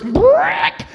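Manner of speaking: high, loud vocal record-scratch noise; sudden screeched scratch effect. Retold or imitated. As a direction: imitate a needle ripping to a stop. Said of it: "where the record goes".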